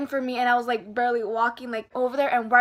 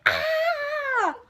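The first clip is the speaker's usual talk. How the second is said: high, drawn-out "ah" of pain; mock scream at a needle jab; acted pain scream. Acts out needle stick.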